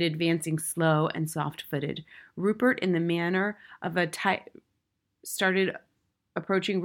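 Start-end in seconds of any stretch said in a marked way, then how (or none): none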